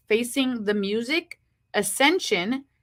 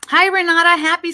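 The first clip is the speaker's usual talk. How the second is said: excited greeting; 6 semitones higher, 8 dB louder than usual. Real, not acted. Greeting a viewer on live stream.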